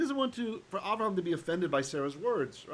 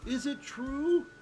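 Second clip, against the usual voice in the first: slow question in a raised pitch; incredulous question; 8 semitones higher, slower than usual. Acted. Teacher translating Sarah's doubt about giving birth, high incredulous question. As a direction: wonder it aloud, high and drawn out.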